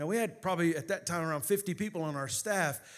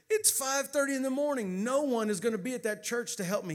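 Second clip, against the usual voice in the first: high-pitched retold protest; arguing back; 7 semitones higher than usual. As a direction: argue back in a raised pitch, incredulous. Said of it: "I argued back with the voice".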